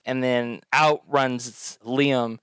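normal talking voice

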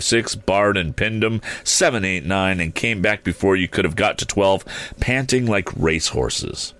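ordinary delivery

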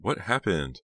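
mildly insistent questioning; demanding. A narrator's reading. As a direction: demand to know, voice lifted. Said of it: "Jade demanded".

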